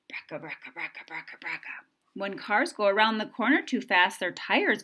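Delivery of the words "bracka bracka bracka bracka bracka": whispered rattling car. A narrator's reading. Old car sound whispered to a child. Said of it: "Old cars sound like this".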